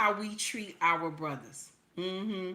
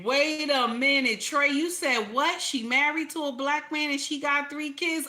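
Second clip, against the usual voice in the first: stunned, can't believe what he hears; shocked disbelief; 6 semitones higher than usual. Real, not acted. Shocked reaction to the clip.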